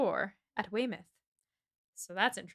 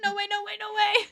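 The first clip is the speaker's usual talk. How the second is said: high, insistent rapid-fire protest; mock alarmed refusal; 12 semitones higher, 3 dB louder than usual. Aunt protesting her niece singing hoarse, high and frantic. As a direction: squeal the refusal on one high note.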